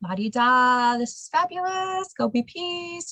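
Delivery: high, breezy la-di-da mockery; mocking airy voice. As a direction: toss it off like it's nothing. Mocking carefree version of peace.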